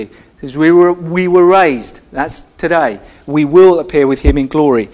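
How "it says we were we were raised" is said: "were" drawn out slowly and leaned on; stress on were. Shown as a demonstration. Preacher stressing the tense for the congregation: slow "were". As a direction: stretch "were" to mark the past tense. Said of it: "put the emphasis on the tenses".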